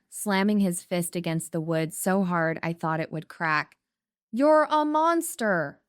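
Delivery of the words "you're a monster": high, slow, loud shriek. A narrator's reading. Mother in hallway shrieking at daughter through door. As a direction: shriek the accusation. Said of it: "my mother shrieked".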